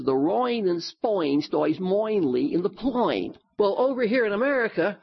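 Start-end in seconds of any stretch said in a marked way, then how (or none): 0.0-3.4 "rain" and "Spain" said as "royn" and "spoyn" in Cockney; mock Cockney vowels, rain as royn; Cockney accent. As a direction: imitate a Cockney flower girl. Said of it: "this Cockney girl who always says".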